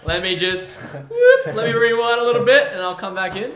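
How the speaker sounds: high, loud flustered backtrack; flustered apology. Acted. Acting out his apology to beachgoers.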